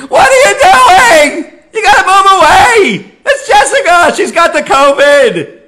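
high, loud, panicked yelling; frantic scream. Retold or imitated. Son screaming at his father about the covid guest, frantic yell. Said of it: "not a Jerry Seinfeld scream".

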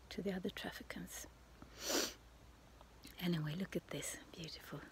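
hushed whisper so the neighbors won't hear. Real, not acted. Near the neighbors' window, talking to camera in a whisper. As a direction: keep it to a breathy hush, close to the mic. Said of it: "I don't know why I'm whispering".

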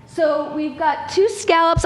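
ordinary talk

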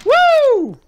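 very high whoop of joy; whooping cheer. Real, not acted. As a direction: let out a wild whoop. Cheering in game.

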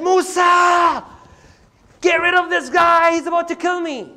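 high, shouted plea to be rescued; desperate call for help. Acted. Oppressed man calls to Musa in the fight, desperate shout.

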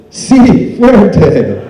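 high, loud gleeful Spanish reply; very excited. Retold or imitated. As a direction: exclaim it with eager relish. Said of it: "He was very excited".

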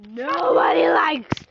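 slow, loud drawn-out yell; drawn-out shouted taunt. Pretend. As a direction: yell it out, stretching the words. Teasing friend, blurts it out.

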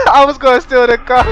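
cracking up, trailing into wheezy laughter; helpless laughter. Real, not acted. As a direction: laugh hard through the words at a failed plan. Laughs as his plan collapses.

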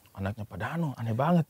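hushed gossip. Retold or imitated. Retells gossip overheard at dinner.

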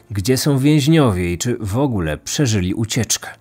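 ordinary delivery

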